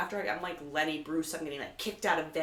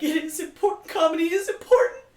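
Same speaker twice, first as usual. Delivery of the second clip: much higher, louder, laughing through tears; laughing and near tears; 9 dB louder than usual. Retold or imitated. To the driver, laughing through tears, much higher and louder. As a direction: gush it, half laughing, half crying. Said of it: "I'm, like, gonna cry".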